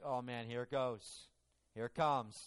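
low, quiet resigned aside; weary dread. Retold or imitated. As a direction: imitate someone bracing for trouble, low. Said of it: "And he's like".

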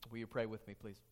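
ordinary talk

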